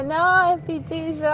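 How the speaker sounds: high, bright mock boyfriend voice; boyfriend's reply. Teller imitating her boyfriend, high and sheepish. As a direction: squeak it like the embarrassed boyfriend. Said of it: "me dice".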